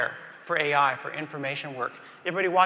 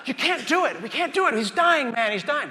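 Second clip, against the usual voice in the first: much higher, frantic he's-dying cry; panicked protest; 8 semitones higher than usual. Acted. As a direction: beg them to stop in full panic. Rookie panics over stabbing patient.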